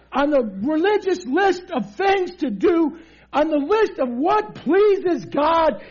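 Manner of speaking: high-pitched, emphatic sermon; forceful preaching. Real, not acted. Preacher voicing excuses for passing by, worked up. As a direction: pitch it up and press every point.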